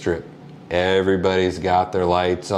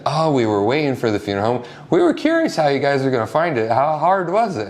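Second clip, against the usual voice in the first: eager curious; 7 semitones higher, faster than usual. Retold or imitated. Camper tells funeral worker they were waiting, fast and eager. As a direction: rush the questions with bright curiosity.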